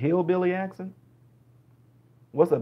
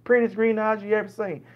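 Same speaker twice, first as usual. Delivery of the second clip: higher-pitched rambling Southern storyteller; slow-to-the-point Southern dialect; 5 semitones higher than usual. Acted. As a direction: ramble sociably in a Southern accent, in no hurry. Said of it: "so they'll go all the way around".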